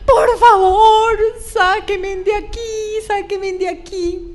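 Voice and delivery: high, slow, tearful yells for help; a trapped daughter begging her parents; crying scream. Retold or imitated. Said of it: "Cuando yo les empiezo a gritar". (grito lloroso, agudo y lento: sáquenme de aquí)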